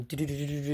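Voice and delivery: soft, rolling drum sound; imitated drums. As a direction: mimic a drum roll under your breath. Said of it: "then the drums come".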